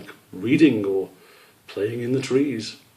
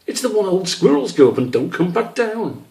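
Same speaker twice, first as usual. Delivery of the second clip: high, stumbling, slowing as he loses count; a confused little kid counting on his fingers; flustered young squirrel; 9 semitones higher, 3 dB louder than usual. Acted. Squish trying to work out his own long name, flustered. Child voice again.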